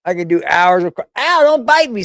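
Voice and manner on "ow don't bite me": startled ow. Real, not acted. Cat bites her.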